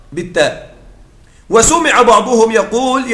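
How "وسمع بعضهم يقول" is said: usual speaking voice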